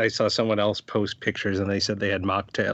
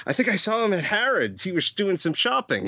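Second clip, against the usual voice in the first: put-on light British accent, higher; 7 semitones higher than usual. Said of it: "Did you say it with that accent too".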